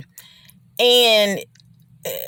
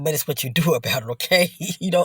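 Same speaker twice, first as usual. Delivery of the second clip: ordinary talk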